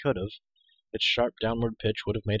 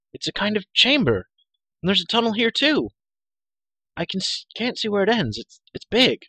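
high, loud, halting on the discovery; excited cry; 11 semitones higher, 7 dB louder than usual. A narrator's reading. Hero reporting the tunnel to the girl above, excited. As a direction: call it out excitedly. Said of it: "he cried".

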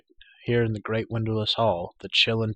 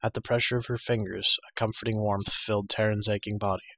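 usual speaking voice

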